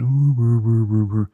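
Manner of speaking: mock glum moaning; down in the dumps. Podcaster mimicking a downer host, mock moping. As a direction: make a droning, put-on mope of ooh. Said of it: "just down in the dumps".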